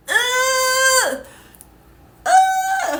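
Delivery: high, drawn-out squeal of embarrassment; cringing groan. Real, not acted. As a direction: squirm out loud, high and long. Cringing at old photos.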